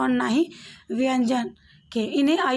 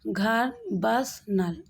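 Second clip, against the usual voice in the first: घर, बस, नल spoken very slowly, word by word; slow careful examples; 2 semitones lower, much slower than usual. Shown as a demonstration. Teacher reading short-a examples slowly to pupils. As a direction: spell out each example slowly. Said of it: "इसके उदाहरण हो गया".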